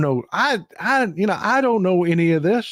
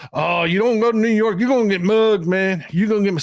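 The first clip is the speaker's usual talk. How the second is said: hammed-up scaremonger warning, pitched higher; mock warning; 5 semitones higher than usual. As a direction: play the alarmed Texan neighbour warning about muggings. Mimicking warnings about New York.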